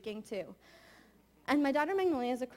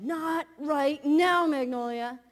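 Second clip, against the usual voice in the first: a tense, loud "not right now"; drawn-out, sharp warning to the child; snapping at child; 4 semitones higher, 10 dB louder, much slower than usual. Retold or imitated. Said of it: "And I said".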